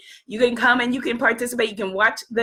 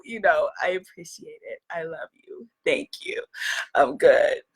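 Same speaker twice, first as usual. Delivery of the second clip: ordinary talk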